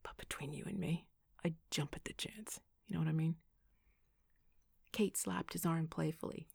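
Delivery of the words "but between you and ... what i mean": hushed, conspiratorial aside; stage whisper. A narrator's reading. Noah leans close to Evan, stage whispering. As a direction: lean in and whisper it confidentially. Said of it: "cupping his hand to stage whisper".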